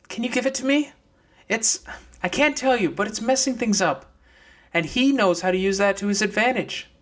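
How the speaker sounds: put-on high, pressing girl's voice; urgent deer girl. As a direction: plead quickly for the watch. Deer urges Clementine.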